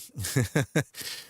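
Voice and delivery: short rising chuckle at own joke. Real, not acted. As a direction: chuckle to yourself at your own innuendo. Chuckling at own joke about Sweeney.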